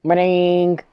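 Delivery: high, loud, stretched ringing noise; drawn-out "ring" sound effect; imitated phone ring. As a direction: mimic a phone going off. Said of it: "his phone starts ringing".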